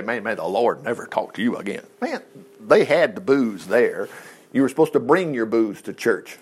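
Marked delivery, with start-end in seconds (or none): 0.0-1.8 lower, softer mock priest's curse; a hint of a harsh priest. Preacher mocking what Eli didn't say, low and soft. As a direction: mutter it like a bitter old priest. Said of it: "He didn't say".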